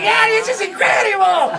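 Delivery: high, emotional acted excitement; mock elation. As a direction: gush in high, hammed-up amazement. Acting out joy at free money.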